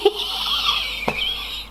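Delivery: high, airless wheeze of laughter; breathless laugh. Real, not acted. Reading about a blood red key, a wheezing laugh. Cracking up at the fic.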